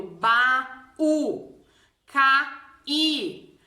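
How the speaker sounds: "baú, caí" silabados, BA-Ú, CA-Í; hiato isolado. Shown as a demonstration. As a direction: separe as vogais de "baú" e "caí" com pausa clara. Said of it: "aparecem isoladas como sendo hiato". (sílabas soltas e pausadas)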